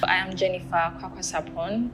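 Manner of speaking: normal talking voice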